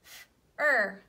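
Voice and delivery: "F, f, er, fur" sounded out one piece at a time, loud on "er"; loud phonics blending; sound blending demo. Shown as a demonstration. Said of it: "Let's mark it".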